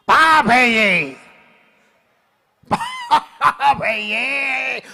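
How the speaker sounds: slow, loud, drawn-out verdict; hammed-up tragic declaration; dramatic pronouncement. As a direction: intone it like a grave stage actor. Dramatic actor impression.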